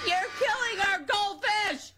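high, drawn-out screechy yell; imitated kids' screaming. Driver retelling the kids on the bus, imitated high screaming. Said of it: "they were screaming and going".

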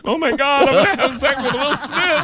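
high, giddy squeal of disbelief; mock excited freakout. Retelling his own reaction to the news, high and excited. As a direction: act starstruck and thrilled about Will Smith. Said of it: "I was like".

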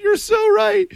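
sudden high shout of agreement; delighted yelp of agreement; excited shout. Real, not acted. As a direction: burst out way up high, thrilled.

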